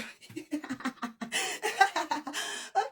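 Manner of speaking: high giggling laugh; excited laugh. Real, not acted. Laughing at her own excitement.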